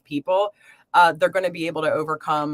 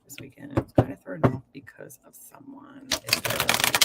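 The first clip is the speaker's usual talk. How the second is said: whispered under her breath to the cards; whispered question; 4 semitones lower, 4 dB quieter than usual. Real, not acted. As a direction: whisper the question privately, as if asking the deck.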